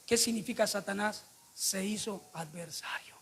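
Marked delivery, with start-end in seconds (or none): 1.5-3.1 whisper. Acted. Dramatic aside in sermon.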